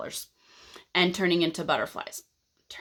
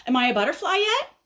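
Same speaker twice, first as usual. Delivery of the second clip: high, quick pestering question; impatient caterpillar; 7 semitones higher, faster than usual. Acted. Caterpillar pesters again in his chrysalis, impatient. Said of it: "So now he says".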